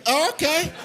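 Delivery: put-on high squeaky little kid voice; meek child's surprise; high startled kid. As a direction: squeak it out while lifted. Being picked up as kid.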